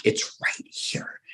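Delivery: slow, drawn-out whisper; urgent whisper. Retold or imitated. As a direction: whisper it slowly, as if the bear could hear. Hushed warning beside the bear.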